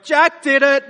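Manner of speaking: put-on high, loud childish tattle; tattling kid. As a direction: blurt out the blame like a snitching kid. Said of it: "[name] went".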